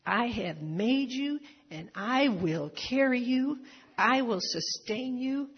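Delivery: a lightly voiced, slow divine command; commanding voice of God. Shown as a demonstration. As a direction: proclaim it as God giving orders. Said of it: "he's like in a command voice here".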